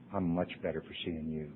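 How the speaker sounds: Jimmy gently repeating his kind words. Retold or imitated. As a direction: repeat it almost under the breath.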